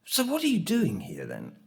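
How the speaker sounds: a hint of a teacher catching someone out; retelling a teacher's query at the old school; teacher questioning.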